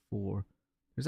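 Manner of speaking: ordinary talk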